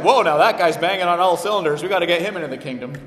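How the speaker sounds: mocking God. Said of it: "God looked down from heaven and was like".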